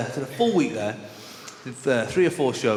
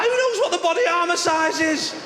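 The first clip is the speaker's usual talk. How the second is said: much higher, louder exasperation; baffled outrage; 4 dB louder than usual. Retold or imitated. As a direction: squeal it, baffled. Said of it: "I was like".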